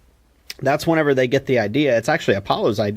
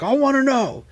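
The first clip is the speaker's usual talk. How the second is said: much higher mock Rocky demanding; imitating Rocky pleading, pitched way up; insistent Rocky; 12 semitones higher than usual. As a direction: push back like a stubborn kid. Rocky insisting to Mickey, retold.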